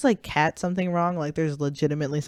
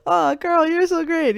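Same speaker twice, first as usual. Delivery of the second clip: much higher, gushing mock praise; mock fawning; 11 semitones higher, 4 dB louder than usual. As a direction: simper it sarcastically.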